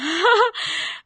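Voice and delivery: high, bright burst of laughter; a quick delighted laugh. Real, not acted. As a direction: laugh out at the comment. Laughs at a viewer's comment.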